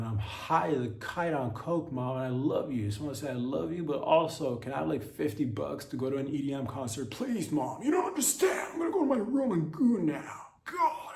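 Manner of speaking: put-on dreamy kid bragging to mom; high teen son.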